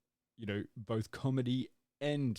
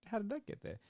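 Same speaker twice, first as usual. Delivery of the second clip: imitating baffled disbelief, pitched higher; a higher, stunned what, how did that get there; bewildered what; 11 semitones higher than usual. Retelling seeing the actress turn up, higher mock bewilderment. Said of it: "and I'm like".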